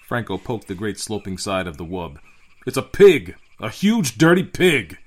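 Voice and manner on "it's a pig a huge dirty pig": loud, high outburst; captain's raised, appalled voice; disgusted exclamation. A narrator's reading. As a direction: exclaim in outraged disbelief.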